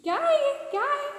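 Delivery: put-on high, bright question to kids; teacher quizzing toddlers in a high excited voice; eager quiz. Shown as a demonstration. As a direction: ask like a playful teacher. Teacher quizzing small children.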